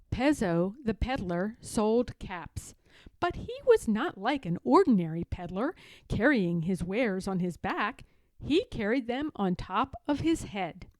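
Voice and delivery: ordinary delivery, nothing marked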